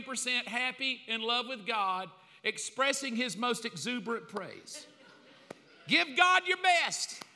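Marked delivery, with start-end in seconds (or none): 5.9-7.2 high, loud, slow call; preacher pushing the congregation; rallying cry. Retold or imitated. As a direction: urge the whole room on. Hype call in church.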